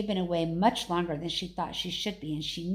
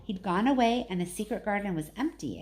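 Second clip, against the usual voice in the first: ordinary delivery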